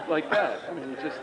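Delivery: normal talking voice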